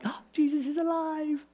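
high, lifted cry of delight; excited joy. Retold or imitated. Preacher showing his own Easter joy, high and delighted. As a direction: let the joy break out high. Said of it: "excitement I get when I'm like".

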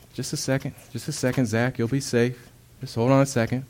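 soft, hushed and calm; gentle reassurance. Acted. Jesus calming the struggling man Zach, soft reassuring hush. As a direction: soothe him like a frightened child. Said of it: "And he goes".

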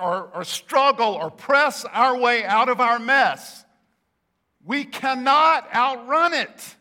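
full-force preacher, loud and drawn out; emphatic preaching. Real, not acted. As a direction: drive each phrase home to the congregation.

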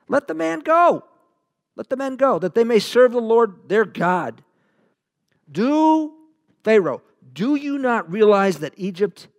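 high, loud appeal to the ruler; urgent pleading. Acted. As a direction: implore Pharaoh as a fed-up servant. Servants plead with Pharaoh, acted out.

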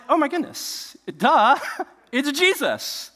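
high-pitched, comic excitement; acted realization. Disciple in the boat spots Jesus, acted excitement. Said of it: "one of the disciples in the boat is like".

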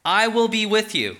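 God reassuring a frightened Moses. Acted. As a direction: promise it steadily. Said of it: "he says".

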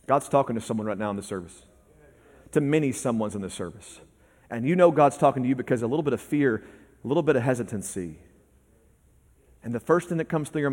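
quiet, intimate preaching; soft sermon voice. Acted. Preacher speaking gently to the congregation, quiet and intimate. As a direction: draw the congregation in softly.

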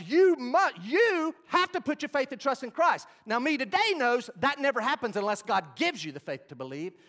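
high, very loud pulpit proclamation; full-force preaching. Real, not acted. Preacher telling congregation about his college paper, full-force preaching. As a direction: preach it out at the top of your voice.

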